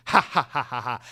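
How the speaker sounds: loud, deliberate fake cackle; mock villain cackle. Boss gloating over his new underling, mock cackle. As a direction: laugh like a gloating boss, hammed-up. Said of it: "laughs and cackles and goes".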